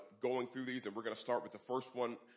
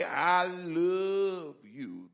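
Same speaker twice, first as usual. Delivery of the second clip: tender; 5 semitones higher, 8 dB louder, much slower than usual. Acted.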